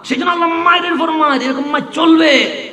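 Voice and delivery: high, loud, ringing sermon cry; full-force waz preaching shout; fiery preaching. Acted. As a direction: preach it at the top of your voice, stretching the quote.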